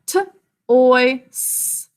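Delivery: "t", "oi", "s" as separate sounds, the "s" just a hiss of breath; sounding out. Shown as a demonstration. Teacher splitting the second syllable into "t" "oi" "s". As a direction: give each of "t" "oi" "s" on its own. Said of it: "Sound it out with me".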